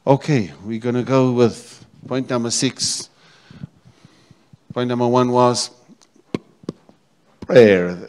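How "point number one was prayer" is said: announcing. Acted. Preacher announcing point.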